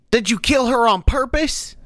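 high, upset youngster's voice; accusing lad. Acted. Sickened lad accusing the cleric of killing her, high and upset. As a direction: ask it high-pitched, accusingly.

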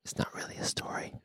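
unvoiced whisper. Real, not acted. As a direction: whisper the aside. Whispered aside.

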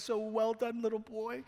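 put-on teasing colleague talks down like to a little boy; mocking pastor. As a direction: pat him on the head with your voice. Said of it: "he also started mocking me".

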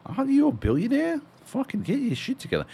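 incredulous scolding. Retold or imitated. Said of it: "And you're like".

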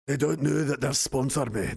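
put-on Scottish brogue; Scottish accent. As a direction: say it as a hammy Scot. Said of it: "Ian hates my Scottish accent".

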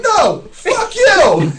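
very high, drawn-out mock yell; hammed-up angry holler, stretched out; retold shouting back. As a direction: hurl the comeback slowly and loudly. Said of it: "And I'm like".

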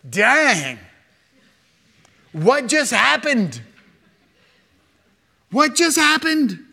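loud, high-pitched astonishment; shocked onlooker. Acted. Onlooker watching fire consume the priests, stunned. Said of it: "If you were watching this from afar".

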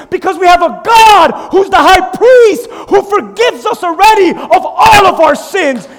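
loud, rising preacher's cry; full-force preaching. Acted. Pastor driving home forgiveness to the congregation, preaching loud. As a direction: preach it hard to the crowd.

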